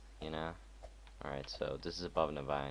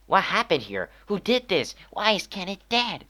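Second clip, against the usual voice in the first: much higher, loud frantic questions; urgent demands; 11 dB louder than usual. Acted. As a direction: demand answers in alarm. Voicing player's urgent questions.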